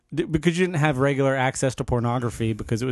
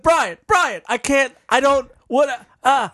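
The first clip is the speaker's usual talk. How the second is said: high, exasperated stammer; flustered protest; 9 semitones higher, 3 dB louder, slower than usual. Retold or imitated. Co-host re-enacting being bombarded with questions, flustered. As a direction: act overwhelmed, cutting yourself off.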